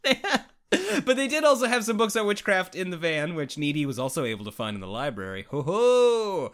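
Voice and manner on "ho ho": high, loud mock-impressed "ho ho"; gleeful ho-ho. Real, not acted. Film fan reacting to witchcraft books in the library, gleeful ho-ho. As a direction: hoot it out high and drawn out.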